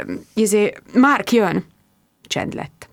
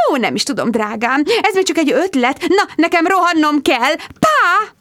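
gushing mother; 8 semitones higher than usual. Acted. Mother sweeping into the café, gushing and high. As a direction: sing it out like a theatrical mother. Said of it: "mother voice".

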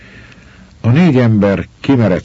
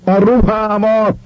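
high, long-drawn desperate shout; frightened yell; much slower than usual. A narrator's reading. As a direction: holler it across the water, stretching the syllables. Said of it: "ordította Schwarz úr megijedve". (ijedt, elnyújtott ordítás)

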